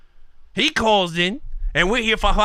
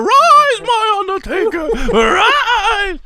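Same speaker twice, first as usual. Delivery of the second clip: much higher, loud wailing summons; Paul Bearer; 10 semitones higher, 4 dB louder, much slower than usual. Retold or imitated. Wrestling fan imitating Paul Bearer raising the Undertaker. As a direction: cry it out like a ringside manager. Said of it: "And then you have Paul Bearer".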